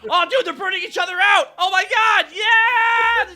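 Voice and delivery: laughing shout of delight; excited cheering. Retold or imitated. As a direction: whoop it up like a thrilled fan. Cheering orcs burning each other.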